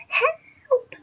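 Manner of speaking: squealed, very high, held-back cry; mock scream. Girl calling for rescue in the car, restrained high mock scream. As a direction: draw out a thin imitation scream. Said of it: "really, really loud".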